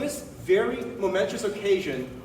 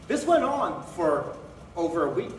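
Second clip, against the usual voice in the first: normal talking voice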